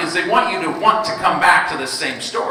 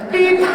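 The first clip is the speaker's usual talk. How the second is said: high electronic beep; scanner beep; 12 semitones higher than usual. Retold or imitated. Cashier scanning groceries, imitating the scanner beep. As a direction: chirp like a checkout scanner.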